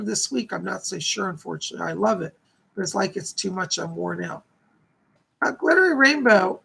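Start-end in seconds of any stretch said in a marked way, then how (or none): 5.4-6.6 calling out. Real, not acted. Calling out the viewer's name.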